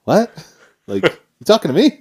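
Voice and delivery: high, loud, puzzled reply; surprised father. Retold or imitated. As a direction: turn around startled. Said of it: "my father turns around and he said".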